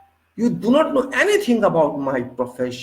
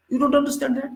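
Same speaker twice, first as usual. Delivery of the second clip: "don't" jumps much higher, scornful repeat; mocking jab; 11 semitones higher, much faster than usual. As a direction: throw "You don't understand that" back at him, higher and mocking. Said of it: "Should I hire a lawyer for you?".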